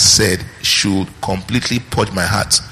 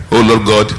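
pompous prayer; 4 dB louder than usual. Retold or imitated. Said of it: "some people say that".

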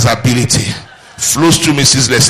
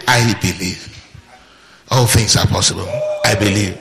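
softer, lower quiet confession; lower, softer calm declaration; 6 semitones lower, 5 dB quieter than usual. Shown as a demonstration. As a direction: say it calmly to yourself as settled fact. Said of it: "Just try and say".

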